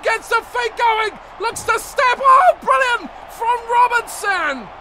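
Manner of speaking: loud, high commentary building to a shout; excited play-by-play. Acted. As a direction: call the break and the try at full pitch. Live call of breakaway try.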